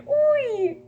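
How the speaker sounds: high squealing uy, half crying out; loud high squeal of embarrassment. Real, not acted. Teasing herself after bragging.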